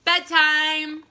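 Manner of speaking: a firm dad calling bedtime; stern father. Acted. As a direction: announce it like a no-nonsense father.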